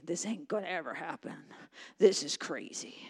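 discouraged mutter. Acted. Voicing discouraged grumbling.

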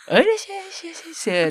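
exaggerated shop greeting. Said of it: "極端すぎる人で".

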